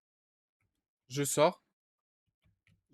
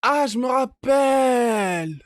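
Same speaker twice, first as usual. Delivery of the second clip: loud, drawn-out, higher exclamation; sudden excited recall; 7 semitones higher, 7 dB louder, much slower than usual. Real, not acted. Gamer suddenly remembering the route, drawn-out excited shout. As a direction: shout it out as it comes back to you.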